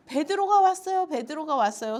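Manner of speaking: high mock shout of astonished news; retold excited cry, easing on the repeat. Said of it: "소리를 지르면서".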